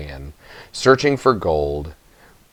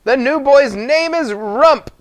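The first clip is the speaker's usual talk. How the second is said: very high, squeaky gnome voices calling; gnomes crying out; slower than usual. A narrator's reading. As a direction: shout the news like tiny gnomes across the mountain. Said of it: "gnomes ran all over the mountain crying".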